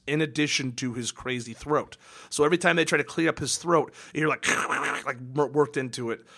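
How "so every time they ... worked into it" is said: ordinary talk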